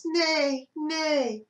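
playful horse neigh. Acted. Horse noise heard by librarian.

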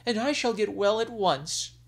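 put-on a young dragon queen coaxing her husband; sick young queen. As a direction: beg sweetly as the ailing bride. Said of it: "the sick queen said".

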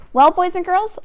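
plain reading voice

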